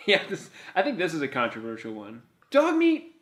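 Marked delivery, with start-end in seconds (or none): 2.5-3.2 higher and louder, shrieked name; high mock shriek. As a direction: squeal "Dog meat" much higher and louder.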